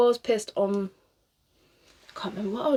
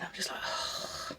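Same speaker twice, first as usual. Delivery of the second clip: whispered aside; 11 dB quieter, much slower than usual. Real, not acted. Exasperated aside before a sigh.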